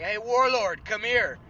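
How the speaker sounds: put-on a gruff old boss hollering him over; imitating an old man's loud shout; old man calling out. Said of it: "So he goes like".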